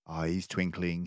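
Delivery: ordinary delivery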